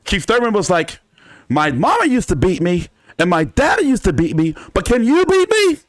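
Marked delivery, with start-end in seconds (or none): 1.5-5.8 starts conversational then climbs to a high, loud shouted peak; building shout. Retold or imitated. Boxer Keith Thurman yelling his boast at the interviewer, shouted. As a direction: ramp it up until the last words are yelled high and loud.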